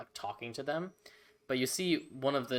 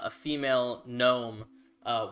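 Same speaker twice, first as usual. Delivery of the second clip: usual speaking voice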